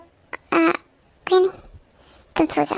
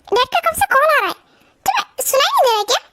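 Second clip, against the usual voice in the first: urgent; 8 semitones higher, faster than usual. Acted. Urgent call to go to hospital.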